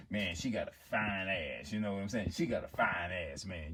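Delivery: low, soft guy-to-guy talk; man confiding in another man. Acted. Man talking privately to another man about a woman, low boasting. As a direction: brag low and offhand, as between two men.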